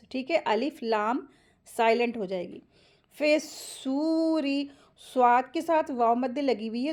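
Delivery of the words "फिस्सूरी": फिस्सूरी with held s and long oo; joined and stretched. Shown as a demonstration. Teacher models joining letters, held s and long oo in फिस्सूरी. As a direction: join into the heavy s of फिस्सूरी, stretch oo. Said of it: "दो हरकत खींचेंगे".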